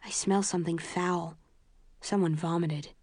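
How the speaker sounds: ordinary delivery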